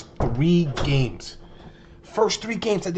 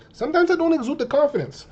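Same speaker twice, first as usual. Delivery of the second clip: higher pitched; 10 semitones higher than usual. Real, not acted. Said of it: "I won't lie to you".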